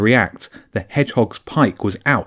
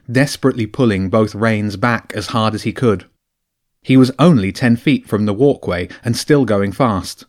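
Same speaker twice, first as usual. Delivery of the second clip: ordinary talk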